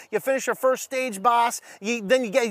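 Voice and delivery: normal talking voice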